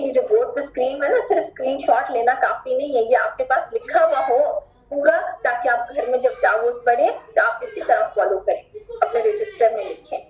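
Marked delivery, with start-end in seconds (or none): none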